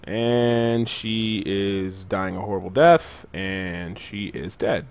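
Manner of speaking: very slow, stretched-out countdown to a kill; drawn-out commentary. Real, not acted. Player calling the boss's death, drawn-out commentary. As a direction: draw the words out as the boss falls. Mock-dramatic commentary on boss dying.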